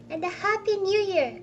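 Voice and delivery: high, loud parting wish; defiant cheer. Acted. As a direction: call it out cheerily over his dismissal. Nephew calls out parting wish.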